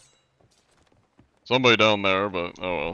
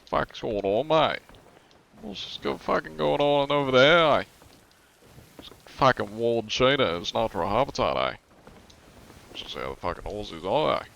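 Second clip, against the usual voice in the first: laid-back Australian accent, lifting line ends; chill Aussie; 4 semitones higher than usual. Shown as a demonstration. Streamer demonstrating the second type, chill Australian accent. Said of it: "chill Aussie. That's like".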